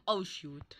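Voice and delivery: soft unvoiced whisper; whispered oh shoot. Real, not acted. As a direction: breathe it under your breath. Quiet dismay.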